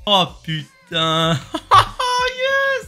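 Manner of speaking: drawn-out gasp then high squealing laugh; ecstatic squeal. Real, not acted. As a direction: burst into a thrilled squeal. Unboxing the new iPhone.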